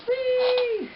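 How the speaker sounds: high, delighted squeal; gleeful whee. Real, not acted. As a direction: squeal like on a ride. Trains racing together.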